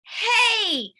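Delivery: high loud protest from the floor; shouting. Acted. Knocked-down boy shouts at the bully, high and loud. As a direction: yell it up from the floor. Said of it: "shouts Schubert from the floor".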